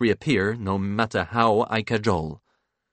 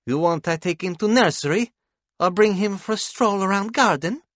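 higher-pitched woman, rising questions; wife's character voice; 10 semitones higher, 4 dB louder than usual. A narrator's reading. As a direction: offer it in a lifted female character voice. Wife voiced with foreign syntax.

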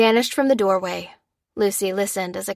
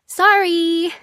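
high, loud, drawn-out sorry; showy sorry; 10 semitones higher, 5 dB louder than usual. A narrator's reading. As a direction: call it back loudly with flair. Daughter's showy retort to dad.

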